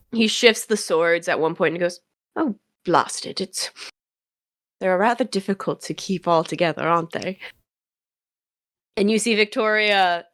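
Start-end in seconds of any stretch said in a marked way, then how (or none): 2.4-7.4 understated British gentleman; mildly flustered, in a British accent. Acted. Arthur juggles the swords at one point, British accent. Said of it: "Oh, British".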